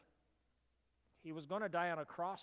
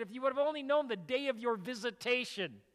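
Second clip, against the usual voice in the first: higher, louder pleading repeat; impassioned lament; 7 semitones higher, 7 dB louder than usual. Retold or imitated. Jesus, grieving over Jerusalem, pleading loudly. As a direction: cry it out with grieving force.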